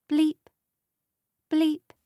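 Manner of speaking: high clipped bleep, twice; high electronic beep; machine bleep. A narrator's reading. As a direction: mimic a hospital monitor. Machine noise imitated.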